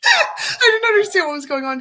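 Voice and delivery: high, loud, half-crying laughter; gasping with laughter at her own embarrassment; laughing hard. Real, not acted. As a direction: lose it completely while telling the story.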